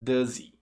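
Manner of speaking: "Does he" as one "duh-zee"; linked, silent H. Shown as a demonstration. Teacher explains the Z link, "Does he" without H. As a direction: join the Z to the vowel in "Does he". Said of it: "Você junta o som do Z".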